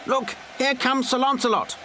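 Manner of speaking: high eager cry from a spectator; crowd member crying out. A narrator's reading. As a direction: shout it out like someone spotting a hero. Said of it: "a voice cried out".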